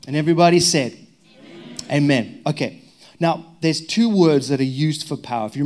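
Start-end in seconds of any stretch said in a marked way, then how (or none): 0.1-2.4 loud call to the room; rally them to answer amen. Real, not acted. Pastor calling the congregation to say amen, loud.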